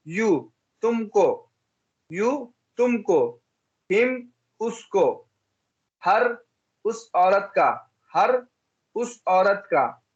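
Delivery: very slow, word by word, for pupils to repeat; लिखवाने वाले अंदाज़ में धीरे-धीरे; slow drill reading. Shown as a demonstration. (बच्चों को दोहराने के लिए धीमे-धीमे बोलना)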